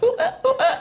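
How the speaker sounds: very high, short "ooh, uh" yelps; high-pitched comic gasping moans; mock pleasure moans. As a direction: squeal in little bursts of mock pleasure. Imitates pleasure from touching himself.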